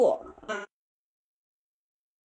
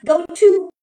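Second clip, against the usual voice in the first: hurried; 5 semitones higher, 9 dB louder than usual. Shown as a demonstration. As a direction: go to work, louder and higher, in a rush. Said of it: "你非常着急". (着急地说go to work)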